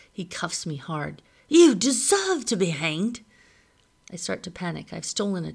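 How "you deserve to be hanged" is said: loud, higher, slow scornful outburst; angry condemnation. A narrator's reading. Husband condemning the boy who stole his child, angrily. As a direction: spit out the verdict in anger. John cuffs and condemns him.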